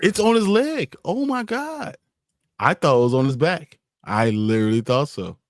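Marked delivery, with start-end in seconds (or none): 0.0-2.0 surprised. Real, not acted. Discovering the battery spot.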